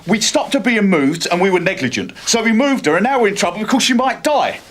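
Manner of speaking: heated self-justification to a superior; exasperated protest. Acted. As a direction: cut in and argue your case. Constable cuts in on superior.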